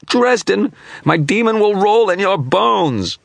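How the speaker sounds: very high, loud, slow shriek of rage; pained shriek. A narrator's reading. Dying image cursing Dresden in pain, very high shriek. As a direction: shriek the curse as the spell tears it. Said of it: "broke off into a scream of anguish".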